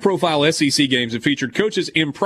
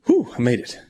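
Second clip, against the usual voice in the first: gasping after a long read; breathless relief after holding breath; 3 semitones lower than usual. Real, not acted. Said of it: "without taking a breath".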